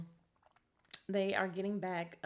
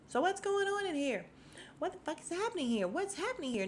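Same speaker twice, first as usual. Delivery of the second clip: exasperated demand; 11 semitones higher than usual. Retold or imitated. Mimicking a character's repeated demand.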